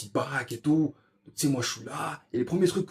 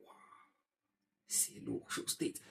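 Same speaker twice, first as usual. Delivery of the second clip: chuchoté émerveillé; 4 semitones lower, 20 dB quieter than usual. Retold or imitated.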